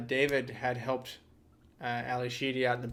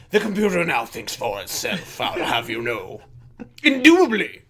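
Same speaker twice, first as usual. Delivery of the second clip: hammed-up upper-class British accent; very proper British accent; 8 dB louder than usual. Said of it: "I could have gone very proper with the British accent".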